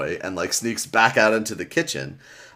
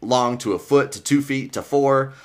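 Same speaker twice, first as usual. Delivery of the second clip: ordinary talk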